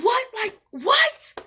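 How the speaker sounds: high, loud reenacted disbelief; incredulous outcry. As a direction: exclaim it in shrieked bafflement, pitch jumping up. Acts out bewilderment at the ride.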